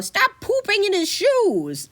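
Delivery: high, slow scolding yell; wizard yelling. Acted. Wizard yells at cat Akiro, high scolding shout. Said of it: "yells the wizard".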